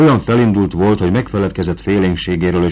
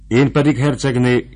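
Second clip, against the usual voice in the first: higher, raised eager exclamation; eager cry; 7 semitones higher than usual. A narrator's reading. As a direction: jump in eagerly, voice lifting. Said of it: "kiáltotta a fejedelem".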